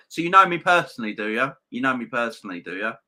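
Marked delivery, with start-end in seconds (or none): 0.1-1.5 higher, louder and fast, squaring up; indignant challenge. Real, not acted. Streamer to insulting commenter, loud indignant challenge. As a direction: throw the question back hard at him.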